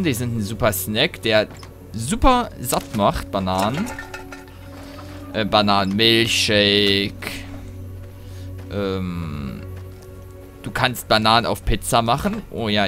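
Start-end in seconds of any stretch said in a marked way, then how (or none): none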